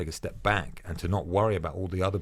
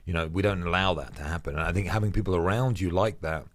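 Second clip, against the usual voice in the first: ordinary talk, nothing marked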